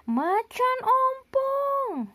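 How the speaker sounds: high, slow alarmed yell; shouting. A narrator's reading. Pak Badru yells at the poster in alarm. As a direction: cry out as if spotting danger.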